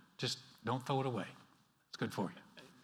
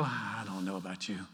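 doubting God voice; 6 semitones lower, 4 dB quieter than usual. Pretend. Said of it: "you come close to him and you go".

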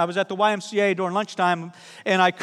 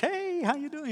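light mock nurse greeting; 9 semitones higher, 8 dB quieter than usual. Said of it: "next time I preach".

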